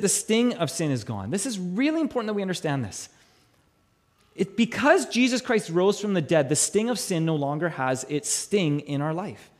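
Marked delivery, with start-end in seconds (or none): none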